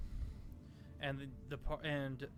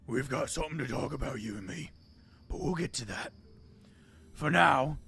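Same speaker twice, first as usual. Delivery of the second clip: quick, loud threat, then a loud final word; menacing half-orc; 6 dB louder than usual. Acted. Half-orc Ravix threatening Drogar across the room, menacing. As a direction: lean in and threaten.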